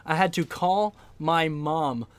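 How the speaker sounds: drawn-out word by word for emphasis; slow right down, pausing around each phrase; deliberate, incredulous slow repeat; slow, emphatic. Real, not acted. Repeats it slowly for comic emphasis.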